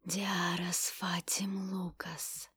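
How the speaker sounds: slow whispered spell; шёпот заклинания. Acted. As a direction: breathe the incantation out slowly. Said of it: "прошептал заклинание". (медленный шёпот заклинания)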